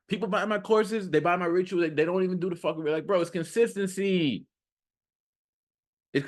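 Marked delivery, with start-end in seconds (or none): none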